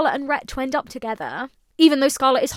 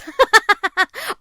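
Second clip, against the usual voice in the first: high laugh; 10 semitones higher, 8 dB louder than usual. Real, not acted. Laughing at wine snobs.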